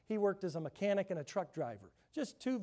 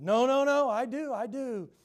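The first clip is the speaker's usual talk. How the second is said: high, loud, urgent insistence; no no, pressing the point hard; insistent protest; 7 semitones higher, 10 dB louder than usual. Retold or imitated. A churchgoer insists to his pastor he must apologize, urgent and loud.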